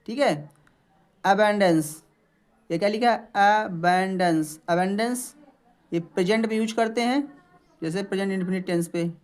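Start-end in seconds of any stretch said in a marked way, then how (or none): none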